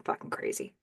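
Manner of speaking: very low, voiceless acted mutter; muttered under breath. Man left behind as she drives off, muttering under his breath. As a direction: drop it right down, almost inaudible. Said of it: "he just mutters under his breath".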